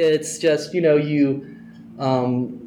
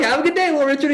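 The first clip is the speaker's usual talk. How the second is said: much higher, chirpy; high cheery farewell; faster than usual. Retold or imitated. Fan saying goodbye to [name], high. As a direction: call it back up high.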